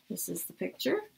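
ordinary delivery, nothing marked

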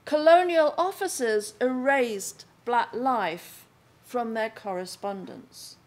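usual speaking voice

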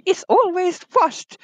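ordinary talk, nothing marked

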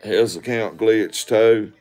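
ordinary talk

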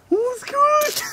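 imitating a hype guy. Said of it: "This person went".

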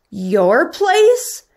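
high, rising question; incredulous, pitch climbing at the end; surprised exclamation. A narrator's reading. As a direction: ask it in storybook astonishment. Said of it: "Patrick exclaimed".

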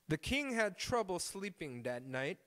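ordinary talk, nothing marked

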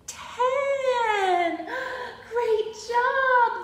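high, stretched finish with a gasp; excited praise. Acted. Storyteller cheering friends who reached ten, excited. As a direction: cheer the end of the count brightly.

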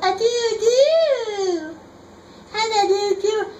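high, slow hooting call; bird call. Acted. Reading the book aloud, high bird call. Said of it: "He can sound like an owl".

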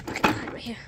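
ordinary talk, nothing marked

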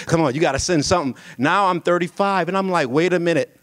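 usual speaking voice

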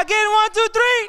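loud, high count-in for a chant; rallying the crowd. Real, not acted. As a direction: shout the crowd into another round. Leads audience in a chant.

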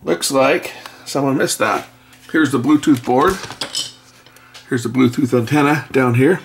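ordinary delivery

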